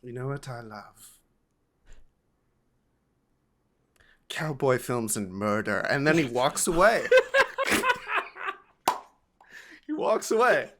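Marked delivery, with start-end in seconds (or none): 0.0-5.8 whispered confession. Retold or imitated. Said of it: "he goes". 7.1-8.5 real high-pitched laugh; high burst of laughter. Real, not acted. Laughing at grandfather's line. 9.9-10.7 laughing. Real, not acted.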